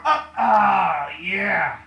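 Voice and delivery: a real straining cry, pushing through the last rep; strained shout of effort. Real, not acted. Coach straining through the final lift, shouting with effort. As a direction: let it burst out under the strain.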